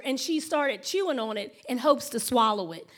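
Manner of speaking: ordinary talk, nothing marked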